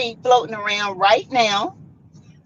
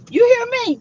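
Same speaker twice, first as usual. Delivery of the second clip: usual speaking voice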